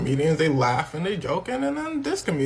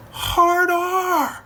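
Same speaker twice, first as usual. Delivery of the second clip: much higher and louder in a squeal; squealed mock outburst; 13 semitones higher, 6 dB louder than usual. Narrator mimicking the comedian blurting it out, shrieked high.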